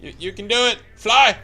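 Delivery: excited cheering. Pretend. Cheering teammate.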